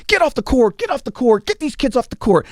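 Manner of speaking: high, hammed-up villain shouting; mock general ordering kids off the court; bad guy yelling. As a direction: play the pantomime bad guy chasing kids off. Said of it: "the general bad guy comes on".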